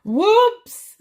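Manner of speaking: high, loud startled yelp; surprised whoops. Real, not acted. Reader catching a slip mid-reading, loud and startled.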